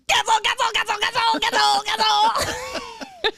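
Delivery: high frantic repeated yell; panicked shout. Retold or imitated. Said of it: "he's going".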